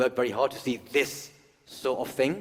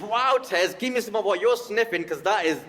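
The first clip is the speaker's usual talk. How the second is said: mock audience voice; 10 semitones higher, 6 dB louder than usual. Voicing an imagined audience member.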